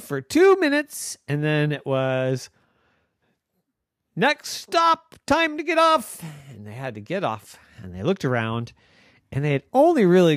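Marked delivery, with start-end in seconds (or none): 4.2-6.2 high announcer call; train announcer. Acted. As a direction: call it like a conductor.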